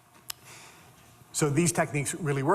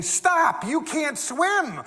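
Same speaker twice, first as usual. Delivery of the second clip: much higher and louder, slowing on swim; urgent warning cry; alarmed shout; 12 semitones higher, 4 dB louder, much slower than usual. Retold or imitated. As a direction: yell to stop her before she reaches the water. Doctor yells at patient near pool.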